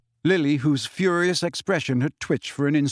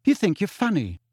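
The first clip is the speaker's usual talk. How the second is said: higher, coldly scornful; 6 semitones higher than usual. A narrator's reading. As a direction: cut him down coldly. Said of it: "she said coldly".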